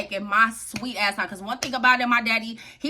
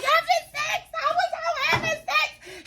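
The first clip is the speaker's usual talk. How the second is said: very high, wailed confession; reenacted panicked confession. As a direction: squeal it out in mock panic. Reenacts confessing during the beating.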